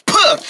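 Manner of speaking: sharp, high spit sound; spitting. Acted. Boy practicing distance spitting, spitting sound. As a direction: spit it out hard. Practicing how far he spits.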